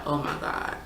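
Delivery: soft unvoiced whisper of despair; whispered oh my God, done with him; whispered exasperation. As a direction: breathe it out, fed up. Exasperated while waiting with guy.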